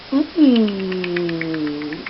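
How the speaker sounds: ordinary talk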